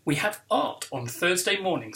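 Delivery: ordinary delivery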